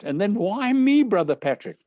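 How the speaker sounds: acted high-pitched pleading; boyish protest. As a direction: question it like a put-upon schoolboy. Boy questions teacher's odd request.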